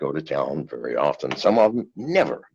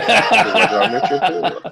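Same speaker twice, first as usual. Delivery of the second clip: laughing, pitched up and louder; 14 semitones higher, 5 dB louder than usual. Real, not acted. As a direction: laugh through the dodge. Laughing while dodging money question.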